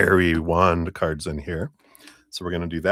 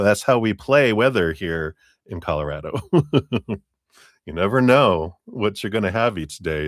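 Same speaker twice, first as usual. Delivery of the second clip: ordinary talk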